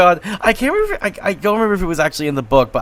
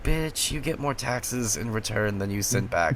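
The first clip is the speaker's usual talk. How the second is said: muttered retort; 5 semitones lower, 6 dB quieter than usual. Retold or imitated. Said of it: "It's like".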